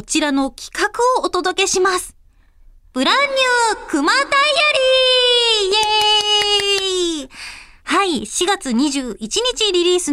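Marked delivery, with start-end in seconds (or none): 2.9-7.3 high, slow, hyped segment shout; title call. Acted. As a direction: announce the corner like a jingle. (コーナー名を高く伸ばして叫ぶ)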